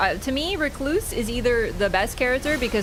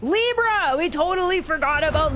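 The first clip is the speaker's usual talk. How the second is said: excited shout; 5 semitones higher than usual. Real, not acted. Streamer spots the boss and shouts with excitement. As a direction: yell it out like you just spotted your target.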